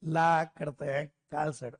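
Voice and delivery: low, slow UP reply; drawn-out UP ला करते हैं; UP accent. Retold or imitated. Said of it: "UP वाले बोलते हैं".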